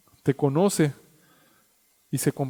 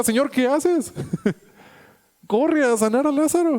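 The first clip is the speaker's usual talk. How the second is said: much higher, half-laughing urgent cry; urgent plea; 9 semitones higher than usual. Retold or imitated. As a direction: hurry him, Lord what are you doing. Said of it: "Dos días se quedó esperando".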